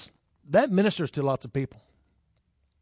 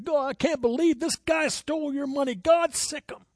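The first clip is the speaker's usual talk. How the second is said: higher, hammed-up indignant plea to God; mock huffing outrage; 11 semitones higher than usual. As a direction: play the huffy believer demanding payback. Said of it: "all huffing".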